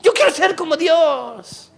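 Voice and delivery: high, loud whiny devil crying mine. Pretend. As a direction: squeal it like a greedy spoiled devil. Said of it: "Y el diablo dice".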